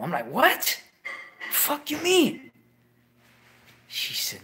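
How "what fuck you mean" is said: high, slow, stunned; hearing that his uncle was killed, stunned; shocked disbelief. Retold or imitated. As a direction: drag it out in disbelief.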